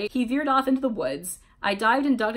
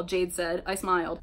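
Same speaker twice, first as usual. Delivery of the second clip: ordinary delivery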